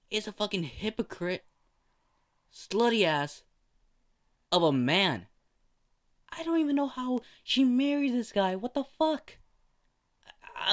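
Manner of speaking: high, loud furious rant; fuming rant. Acted. Nurse quitting in fury over the cheating husband, high angry rant. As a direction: let the anger boil over into quitting.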